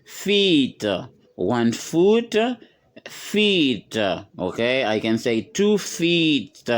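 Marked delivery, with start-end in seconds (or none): 0.0-0.9 FEET with a long, drawn-out ee; long vowel. Shown as a demonstration. Teacher showing learners the long vowel, FEET drawn out. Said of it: "c'est une voyelle longue". 3.1-4.0 FEET held on a long, higher-pitched ee; long vowel. Shown as a demonstration. Teacher modelling the word for learners, FEET with long vowel. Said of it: "c'est une voyelle longue".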